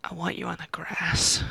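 whispered. Acted. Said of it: "I mumble".

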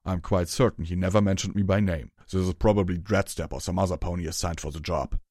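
loud, rough character voice; Hammer Chain's gruff voice. Acted. Hammer Chain explains his posting to Rarity, loud gruff voice. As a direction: explain it loudly and bluntly.

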